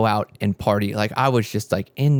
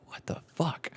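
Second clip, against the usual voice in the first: hushed whisper, much softer; 5 semitones higher, 13 dB quieter than usual. Retold or imitated. Reacting to her soul naming Edison, whispered. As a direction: breathe it out in shock. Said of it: "And I was like".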